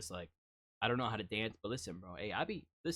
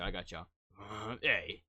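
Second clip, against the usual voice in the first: ordinary talk, nothing marked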